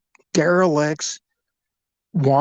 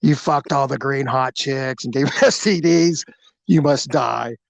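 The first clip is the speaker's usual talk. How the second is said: lizard alien voice. Retold or imitated. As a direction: drag it out like the lizard guy. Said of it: "he talked like".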